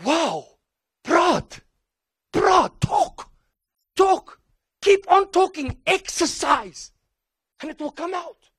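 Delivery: high, coaxing parent voice; encouraging parent. Retold or imitated. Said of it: "the parent is like".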